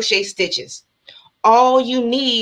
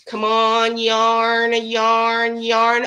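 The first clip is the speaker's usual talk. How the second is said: drawn-out words on one held pitch; suspenseful, lengthened chant; coaxing the spin; much slower than usual. Real, not acted. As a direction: stretch each word out while willing the wheel on. Urging on the prize spin.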